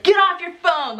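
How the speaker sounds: high, snappy mock yell. Laboring wife snapping at husband on his phone, mock yell. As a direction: scold him off the phone. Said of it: "yell at him".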